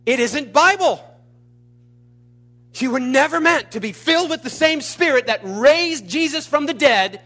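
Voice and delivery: ringing pulpit outcry; forceful preaching. Real, not acted. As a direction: declare it with full conviction, pitched high. Preacher declaring forcefully at full force.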